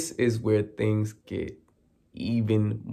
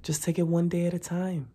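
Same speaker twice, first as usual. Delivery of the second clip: higher, softer, quick parroting of a platitude; mock chorus; 7 semitones higher, 5 dB quieter, faster than usual. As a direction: rattle off the support-group line in mockery. Everyone parroting support phrase.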